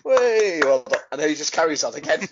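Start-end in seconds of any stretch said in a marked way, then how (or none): 0.0-1.0 louder mock cheer, higher on way; imitating a cheering crowd. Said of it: "everyone just goes".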